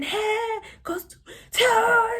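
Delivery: high screaming wail; re-enacted scream. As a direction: scream it out as if gone into a trance. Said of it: "I memeza".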